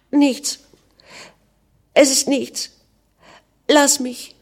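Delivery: high-pitched, acted tearful refusal; tearful sobbing. A narrator's reading. Weeping Anna brushes off her worried husband, sobbing. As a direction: push the comfort away, close to sobs. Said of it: "schluchzte sie".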